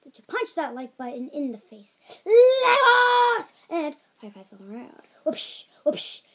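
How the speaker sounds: hyped YouTuber outro with bursts of yelling; Jacksepticeye. Retold or imitated. Kid copying a YouTuber's sign-off, hammed-up impression. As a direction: punch out key words, high and loud. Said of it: "I'm Jacksepticeye".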